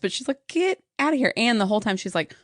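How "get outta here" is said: much higher mock dismissal; retold exasperation. Woman wanting the visitors gone, retold exasperation. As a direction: imitate someone shooing people out.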